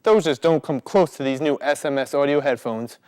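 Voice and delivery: usual speaking voice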